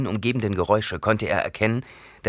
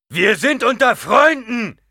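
slow, much higher, louder call; 9 dB louder, slower than usual. Acted. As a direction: call it out to the men slowly. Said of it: "rief Gurney ihnen zu". (laut zugerufen)

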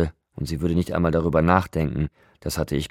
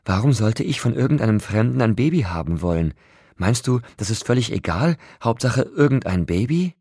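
higher, louder angry questions; wütend; 6 semitones higher, 5 dB louder than usual. A narrator's reading. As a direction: fire off indignant questions, pitch jumping up. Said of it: "Jetzt war sie wütend". (wütend und empört fragend)

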